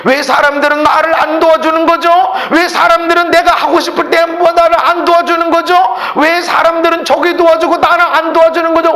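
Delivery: high, insistent acted grievance; resentful complaint. Preacher playing the sick man nobody helps, complaining. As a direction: pile up the accusing questions, each pushed hard. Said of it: "원망, 불평, 탄식하는 사람이".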